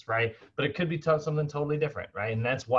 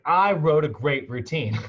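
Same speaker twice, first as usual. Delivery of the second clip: louder, mildly exasperated insisting; frustrated trainer; 5 semitones higher, 7 dB louder than usual. Retold or imitated. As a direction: imitate a trainer who is fed up that his plan is ignored, a bit louder. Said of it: "he was almost frustrated".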